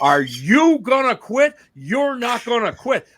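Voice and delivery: high, slow, loud stern jab; high, slow scolding from a short woman; stern mock scolding. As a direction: scold him like a tiny fierce woman, finger in his face. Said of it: "she put her finger in my face and said".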